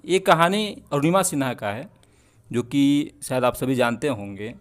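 ordinary talk, nothing marked

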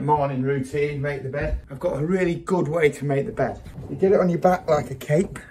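ordinary delivery

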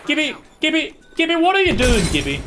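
calling out. Real, not acted. Calling a friend in game.

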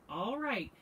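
usual speaking voice

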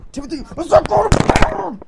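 high, loud call across the room; turning away from phone. Pretend. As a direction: yell away from the phone at the kid. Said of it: "get rid of my son".

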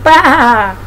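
imitated lamb's baa; lamb bleat. A narrator's reading. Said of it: "she says".

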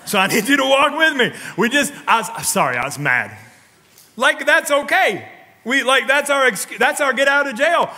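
loud, high preaching voice; full-force preaching. Acted. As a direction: drive it home to the congregation.

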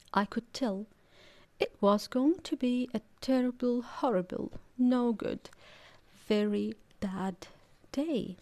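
plain reading voice

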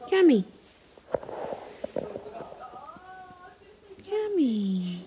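coaxing call to a dog. Real, not acted. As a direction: call the dog's name. Calling the dog gently.

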